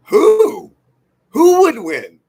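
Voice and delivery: loud high disbelieving yell; incredulous shout. Real, not acted. Mocking claim civil war win.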